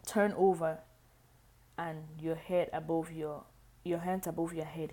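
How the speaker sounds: ordinary delivery, nothing marked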